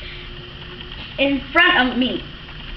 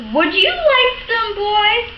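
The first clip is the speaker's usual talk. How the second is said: higher, slower sweet offer; mother's voice; 7 semitones higher, slower than usual. A narrator's reading. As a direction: offer the pudding like a kind mother. Mother offering pudding kindly.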